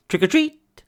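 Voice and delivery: high, loud jump-out shout; Mister Mole's cheerful surprise shout; shouted trick or treat. Acted. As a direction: burst out and startle him. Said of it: "shouted Mister Mole".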